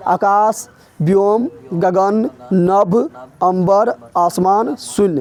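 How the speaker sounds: spaced-out list, rising toward the last items; teacher spelling out a list slowly; slow word-by-word dictation. Shown as a demonstration. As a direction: list slowly, a pause after each word.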